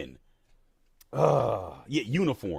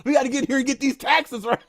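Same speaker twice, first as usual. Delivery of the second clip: mock amped-up, higher voice with a laugh; 10 semitones higher than usual. Streamer mocking himself over taxes, higher amped-up voice. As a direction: play your fired-up older self, half laughing. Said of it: "so amped up".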